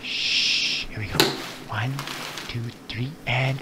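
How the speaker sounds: hushed sneaky whisper; very quiet. Pretend. Man plotting to sneak up on the cereal, hushed whisper. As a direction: whisper it like you're creeping up on something. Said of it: "What if we're very quiet".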